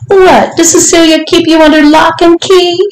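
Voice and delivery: high, gleeful questioning; amused disbelief. A narrator's reading. Lady questioning her visitor, amused and high-pitched. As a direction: ask it high, full of delighted disbelief.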